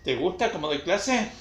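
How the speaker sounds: normal talking voice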